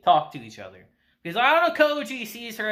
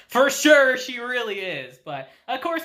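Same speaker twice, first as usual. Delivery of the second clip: normal talking voice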